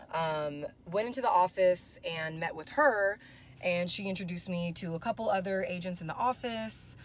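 ordinary delivery

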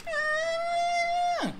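long, very high squeal; thin high-pitched sustained "aah"; fleeing child's scream. Acted. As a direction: imitate a panicked kid's wail. Acts himself fleeing as child.

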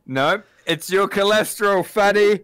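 loud mocking jab at the fat guy; loud teasing. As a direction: shout the insult with a grin. Teasing Big Goosey loudly.

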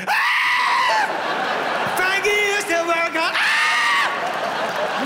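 very high screaming like a frenzied fan; a concert fan's high shrieked lyric. Retold or imitated. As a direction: shriek at the stage from the chairs. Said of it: "we're standing on our chairs".

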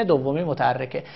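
ordinary talk, nothing marked

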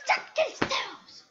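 high, loud, drawn-out yell; high-pitched shouted taunt. Real, not acted. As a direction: yell it slowly at the game. Yelling at the game.

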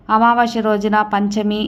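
ordinary talk

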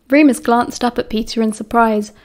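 plain reading voice

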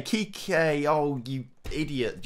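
high call of the name, then a slow exasperated jab; exasperated groan. Real, not acted. Manager groaning at his striker, slow exasperated jab. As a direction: let the miss hurt you.

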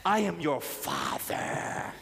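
deep, slow, solemn proclamation; God. Acted. Preacher voicing the Father to congregation, deep and solemn. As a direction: intone it low and very slowly, with authority. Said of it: "What God is trying to say is".

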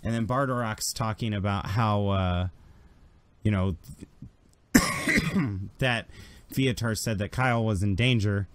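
usual speaking voice

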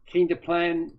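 ordinary talk, nothing marked

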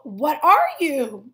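ordinary delivery

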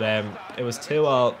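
normal talking voice

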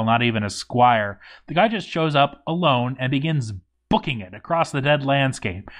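ordinary delivery

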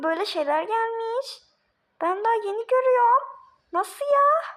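excited. Acted. Child showing new candy to the dwarves, rising excitedly. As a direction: build up to a high astonished squeal.

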